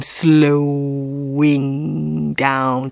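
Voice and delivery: word stretched way out; exaggerated slowing. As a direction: drag it out in slow motion. Acting out slowing at the door.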